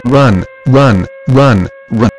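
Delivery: panicked shout. Acted. Announcer telling listeners to flee, in a panicked shout. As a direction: scream at listeners to run.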